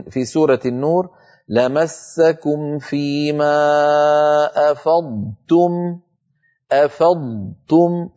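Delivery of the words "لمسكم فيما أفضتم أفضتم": slow chanted "أفضتم", long heavy ض before light ت; correct clear ض. Shown as a demonstration. Teacher modelling a second verse, carefully articulated. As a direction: hold the ض in "أفضتم", then release a thin ت. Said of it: "كذلك الأمر في سورة".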